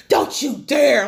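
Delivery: loud, sharp don't you dare; daring warning. Retold or imitated. Daughter confronting her parents, a loud warning. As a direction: warn them off, hard. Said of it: "She's all like".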